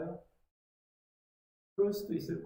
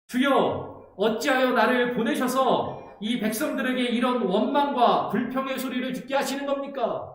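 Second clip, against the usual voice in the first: higher, loud, rising to a shout of complaint; complaining Moses; 3 semitones higher, 3 dB louder than usual. Retold or imitated. Pastor voicing Moses protesting to God, rising to a shout. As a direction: protest to God with mounting frustration. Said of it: "모세가 하는 말이 이렇게 들립니다".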